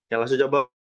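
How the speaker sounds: ordinary talk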